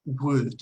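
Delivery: Wood said just like "would"; same-sounding word. Shown as a demonstration. Teacher proves two words match, Wood sounding like "would". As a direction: pronounce Wood alone, short oo before a d. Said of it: "se pronuncia igual".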